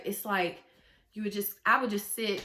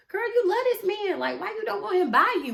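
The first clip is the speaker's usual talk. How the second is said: high, loud mock telling-off; incredulous self-scolding; 11 semitones higher, 5 dB louder than usual. Woman calling herself out over her partner, incredulous scolding. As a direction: call yourself out in disbelief, pitched way up.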